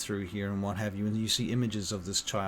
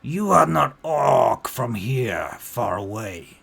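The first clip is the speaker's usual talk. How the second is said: put-on orc child, accusing; 4 semitones higher, 11 dB louder than usual. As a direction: challenge the stranger as a wary orc child. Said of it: "and she says".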